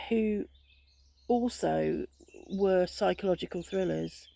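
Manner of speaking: usual speaking voice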